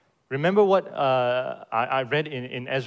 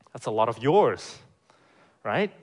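ordinary talk

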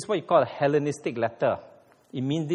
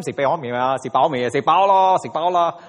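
Cantonese greeting; 3 semitones lower, 6 dB louder than usual. Retold or imitated. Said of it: "You meet a Chinese anytime".

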